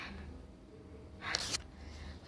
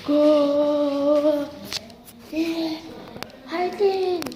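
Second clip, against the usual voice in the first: excited cheer; 3 semitones higher, much slower than usual. Real, not acted. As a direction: shout the goal with joy. Cheering a goal.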